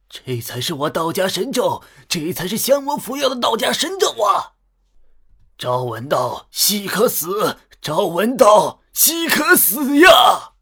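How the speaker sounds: high, loud ecstatic cries, building to a drawn-out scream; frenzied shouting. A narrator's reading. Old Taoists awed by the golden light spell, frenzied shouting. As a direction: shout it in religious rapture, stretching the last words. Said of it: "老道士们癫狂喊道".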